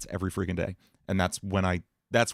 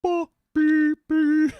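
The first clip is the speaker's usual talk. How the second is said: much higher and louder, formant shifted down; squeaky-yet-dark cartoon dad; imitating Daddy Dearest's high note; 7 dB louder, much slower than usual. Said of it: "you sounded like Daddy Dearest".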